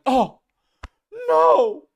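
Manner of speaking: high, loud, shrill mock outrage; mock pearl clutching. Mocking critics clutching pearls, high and loud. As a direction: ham up a scandalized gasp. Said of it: "they were like".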